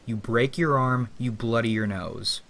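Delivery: usual speaking voice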